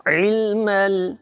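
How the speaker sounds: علم with the ain from mid-throat; Arabic ain from the throat. Shown as a demonstration. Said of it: "حلق کے درمیان سے نکالیں".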